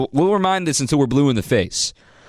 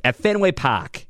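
Boston accent, r dropped so Park sounds like pahk; 5 semitones higher than usual. Shown as a demonstration. Radio host mocks Boston pronunciation for listeners, Boston accent. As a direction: drawl At Fenway Park slowly in a Boston accent. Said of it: "with the real Beaner Boston accent".

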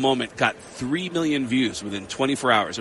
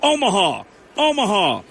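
higher, punched repeats; higher-pitched, identical emphatic calls; mock answer-checking; 5 semitones higher than usual. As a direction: call out each answer separately. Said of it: "let me just check the answers".

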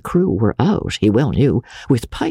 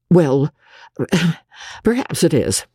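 uneasy sigh before giving in; reluctant young doctor's agreement; hesitant, fearful; 4 semitones higher than usual. A narrator's reading. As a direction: give in uncertainly, a little higher. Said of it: "He watched her fearfully".

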